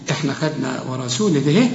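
ordinary talk

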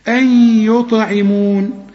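أن merged into the Y of يطعمون with a held hum; slow "ayy-yut'imoon" in أن يطعمون; nasal merging. Shown as a demonstration. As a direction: hold the merged sound between أن and يطعمون. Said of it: "النون بعدها الياء".

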